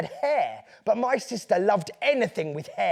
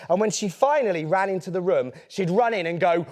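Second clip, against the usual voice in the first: ordinary delivery, nothing marked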